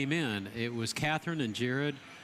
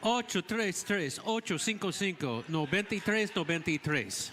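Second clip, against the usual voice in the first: in Spanish; 3 semitones higher than usual. Pretend. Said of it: "Do it in Spanish".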